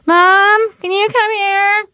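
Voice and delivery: high, drawn-out call for Mom; kid yelling down. Retold or imitated. Kid upstairs yelling down to mom, high holler. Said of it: "they yell down to you".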